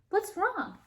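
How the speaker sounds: high, concerned question; worried little girl. Acted. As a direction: ask it with worry. Callie asks worried.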